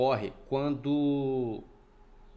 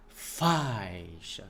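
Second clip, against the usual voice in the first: "faixa" with a long, strong A and weak I; stress on the A; 4 semitones lower than usual. Shown as a demonstration. Teacher showing where the force goes, A stretched in "faixa". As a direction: draw out the A in "faixa", letting the I glide off. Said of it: "Você coloca mais força".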